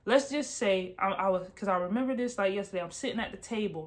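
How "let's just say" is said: usual speaking voice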